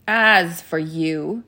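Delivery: loud, slow, menacing address; drawn-out, forceful warning; threatening witch. A narrator's reading. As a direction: round on the offender as the witch. Witch turns on Edmund in anger.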